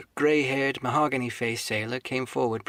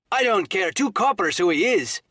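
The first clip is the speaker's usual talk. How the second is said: loud, higher gruff outburst; crying out; 7 semitones higher, 6 dB louder than usual. Acted. Silver shouts about the unpaid bill, loud and gruff. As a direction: bark it out across the tavern. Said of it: "cried Silver".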